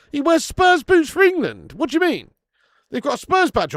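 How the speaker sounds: high, loud, incredulous outburst; outraged disbelief. Real, not acted. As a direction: react indignantly, voice shooting right up. Outraged reaction to viewer's claim.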